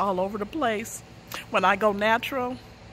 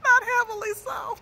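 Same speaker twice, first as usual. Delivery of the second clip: laughing; 11 semitones higher than usual. Real, not acted. Laughing telling embarrassing story.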